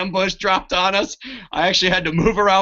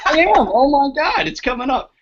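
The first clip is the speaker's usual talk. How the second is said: excited; 7 semitones higher than usual. Real, not acted. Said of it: "I'm so excited".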